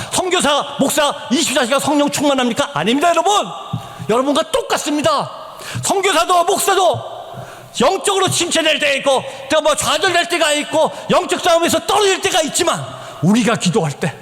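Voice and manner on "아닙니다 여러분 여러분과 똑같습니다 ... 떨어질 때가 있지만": higher, insistent listing; impassioned preaching. Real, not acted. Preacher urging prayer for church and missionaries, impassioned. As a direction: drive each phrase home as an appeal.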